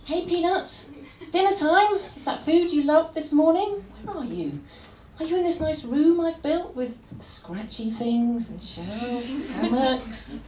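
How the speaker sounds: lightly voiced owner coaxing her cat. Owner calling her cat in for dinner, coaxing. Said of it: "I imagine it goes a bit like this".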